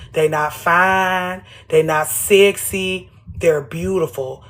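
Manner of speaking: drawn out, word by word; slow it down on each word; slow emphatic. Real, not acted.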